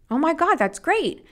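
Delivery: high, bright-pitched cheer; delighted. Retold or imitated. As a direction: quote the happy husband with a jump in pitch. Husband on the phone delighted.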